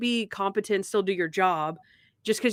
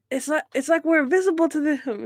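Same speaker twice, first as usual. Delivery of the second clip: higher-pitched retold repetition; insistent repeat; 7 semitones higher than usual. Hobo repeats himself for a puzzled listener, higher and insistent. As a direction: repeat it higher, imitating someone not understood.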